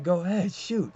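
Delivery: high-pitched mock dare; retold laughing taunt. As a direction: goad him, pitched well up. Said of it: "laughing their asses off".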